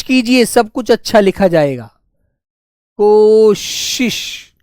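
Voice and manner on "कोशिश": कोशिश stretched out very slowly; slow dictation. Shown as a demonstration. Teacher dictates कोशिश for the pupils to write, very slow. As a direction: hold each sound so they can write it.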